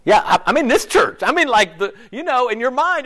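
fired up. Real, not acted. Preacher rousing the church, loud and excited. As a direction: ramp it up for the congregation.

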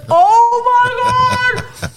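long, high-pitched drawn-out shriek; retold scream. Girlfriend seeing the naked photo, mock scream. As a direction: imitate a screaming girlfriend, stretching it out. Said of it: "Jeff hears me screaming".

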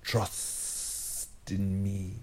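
slow, soft, hypnotic snake croon; drawn-out, soft serpent voice; Kaa the serpent. Retold or imitated. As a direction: lull them in like the python Kaa.

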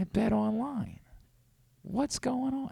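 soft, high impression; hushed, high-voiced puzzlement, put on; Brian Windhorst. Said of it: "it's soft for sure".

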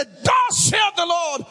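high, ringing preacher's cry; preaching shout. Acted. Preacher rousing the congregation, shouting. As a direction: thunder it at the congregation.

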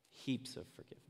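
breathy whisper; whispering heaps of forgiveness to the congregation. Acted. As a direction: drop to a hush on heaps. Hushed aside after preaching.

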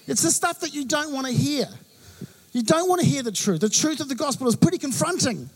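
ordinary talk, nothing marked

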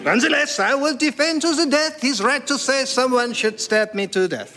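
high French declaiming, dropping at the end; French accent. Acted. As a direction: proclaim high, then sink on the last word. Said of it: "in outrageous French accent".

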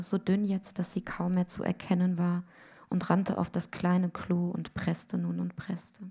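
ordinary delivery, nothing marked

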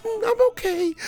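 very high, whimpering child imitation; mock holding back tears. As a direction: pretend to be fine through imitated tears. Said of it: "trying not to show me he's crying".

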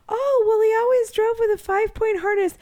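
high, naive mock voice; a shrugging, innocent mock voice; mocking the dead man's friend, high and naive. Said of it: "His friend's like".

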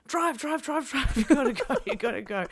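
high, urgent mock yell at the driver; retold yelling. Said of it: "we're yelling at the driver".

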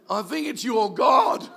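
loud, high line broken by laughter; laughing shout. Retold or imitated. Pastor retelling the landlord's words, laughing shout. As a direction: shout it while cracking up. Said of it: "And he goes".

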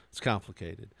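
low, soft dodge; mock evasive answer. Evasive reply about the toxicology, low and soft. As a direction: drop down and brush it off quietly.

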